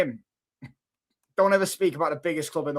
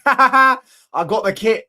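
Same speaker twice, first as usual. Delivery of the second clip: laughing boast; 6 semitones higher, 8 dB louder, slower than usual. Real, not acted. Laughing boast about kit.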